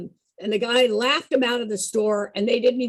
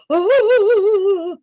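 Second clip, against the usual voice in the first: high, loud ghostly moan; ghost wail; 8 semitones higher, 7 dB louder than usual. Retold or imitated. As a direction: wail like La Llorona. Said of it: "It goes like this".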